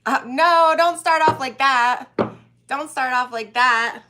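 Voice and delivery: loud laughing no; loud protest. Real, not acted. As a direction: protest loudly, half laughing. Reacting to a cheeky comment.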